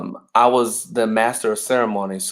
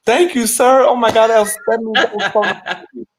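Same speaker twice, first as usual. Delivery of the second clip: high, loud, breathless mock thanks; mock gushing gratitude; 10 semitones higher, 5 dB louder than usual. Mocking the reply the colonel expected, high and gushing. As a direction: gush like a flattered fool, gasping. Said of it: "expecting me to be like".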